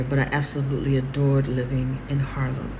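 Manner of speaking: normal talking voice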